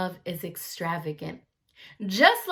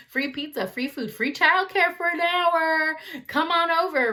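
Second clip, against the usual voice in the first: excited pitch; 8 semitones higher than usual. Retold or imitated. Youth leader luring kids with free pizza, excited. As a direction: sell it like a camp counselor. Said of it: "That's how I get the kids to come".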